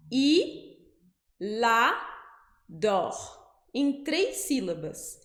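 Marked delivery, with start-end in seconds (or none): 0.1-3.1 i la dor said syllable by syllable; slow syllables. Shown as a demonstration. Teacher spells out i la dor slowly for students. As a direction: break it slowly into three beats. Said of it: "bem devagarzinho pra você perceber".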